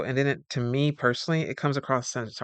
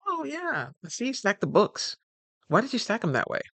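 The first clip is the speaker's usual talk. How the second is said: much higher, mock-condescending boss voice; imitating a fussy supervisor, pitched up; nitpicking boss; 9 semitones higher than usual. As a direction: play the picky manager finding fault.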